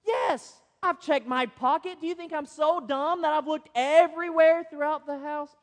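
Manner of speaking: mock outrage at the obvious question; exasperated husband. Husband snapping back at wife, mildly exasperated. Husband's indignant retort voiced.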